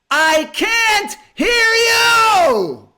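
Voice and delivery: very high, drawn-out scream at full force; anguished scream. Retold or imitated. Struggling man praying to the ceiling, screaming. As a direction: scream it to the heavens, every word stretched out. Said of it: "and he screams".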